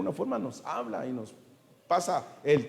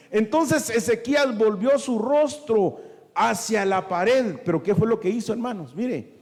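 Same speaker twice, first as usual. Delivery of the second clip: louder, higher preacher's cry; fiery preaching; 3 semitones higher, 4 dB louder than usual. Acted. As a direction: drive it home to the congregation. Preacher building to full force.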